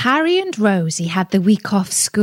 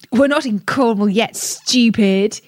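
squeaked; 3 semitones higher than usual. A narrator's reading. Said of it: "he squeaked".

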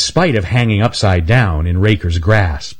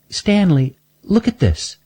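put-on high, young girl's voice; a sweet little girl showing her teeth; Shelby's voice; 11 semitones higher than usual. Shelby shows Stanley her missing teeth, in a high girlish voice.

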